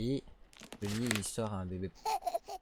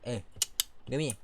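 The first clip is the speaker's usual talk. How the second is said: ordinary talk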